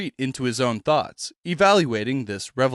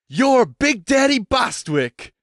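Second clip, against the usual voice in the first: slow, higher, thrilled cry; excited fan; starstruck exclamation; 9 semitones higher, 3 dB louder, slower than usual. Acted. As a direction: gush like a fan meeting his idol. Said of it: "the head exclaimed".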